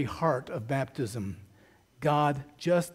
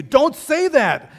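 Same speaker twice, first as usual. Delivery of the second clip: much higher and louder, slow and drawn; full yell; 9 semitones higher, 9 dB louder, slower than usual. Retold or imitated. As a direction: scream it at dad at full force. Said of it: "he yelled at me".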